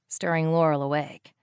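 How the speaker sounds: ordinary delivery, nothing marked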